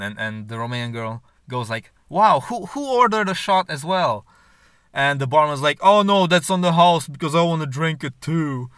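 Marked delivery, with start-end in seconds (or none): 2.1-4.3 raised pitch, imitated amazement; acted surprise. Romanian girl reacting to the poured shots, mock surprised. 5.8-8.7 louder, higher mock-hearty barman; boisterous imitation. Barman offering a drink on the house, boisterous.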